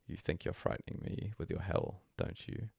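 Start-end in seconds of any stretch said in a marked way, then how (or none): none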